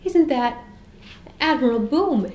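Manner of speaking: startled, voice lifting; very surprised. A narrator's reading. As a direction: ask it in amazed recognition. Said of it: "looking very surprised".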